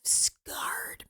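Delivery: whispered, slow and sulky; mock wounded child. Mother imitating her wounded son, whispered and slow. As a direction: hush it like a hurt little boy. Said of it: "Mars was just like".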